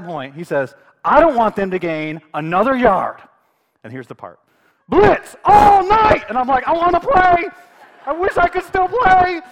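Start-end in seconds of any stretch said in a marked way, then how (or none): 1.0-3.2 loud, forceful coach's pep talk; intense. Retold or imitated. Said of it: "it gets intense". 4.9-6.2 full-force, high, drawn-out battle cry; rallying shout. Retold or imitated. Acting out coach's rallying shout. 6.7-9.4 high, full-force excited yell; fired-up shouting. Acted. Acts out own fired-up reaction.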